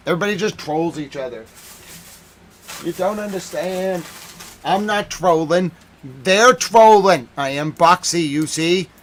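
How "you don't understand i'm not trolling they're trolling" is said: mock shout. Mocking Iranian denial.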